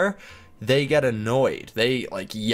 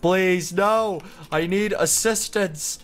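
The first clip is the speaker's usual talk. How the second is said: mock desperate plea; 7 semitones higher, slower than usual. Trapped under the world, begging anyone for help in mock panic. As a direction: beg for rescue, overplayed.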